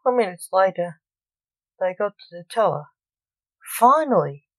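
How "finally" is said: exasperated. Acted. Impatient son reaches teller.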